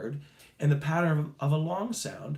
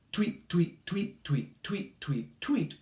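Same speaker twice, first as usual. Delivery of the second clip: bird tweets; 3 semitones higher, slower than usual. Shown as a demonstration. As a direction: chirp like a little bird. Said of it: "take your fingers and go".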